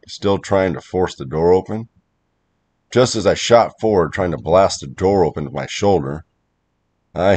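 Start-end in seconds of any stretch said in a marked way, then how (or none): none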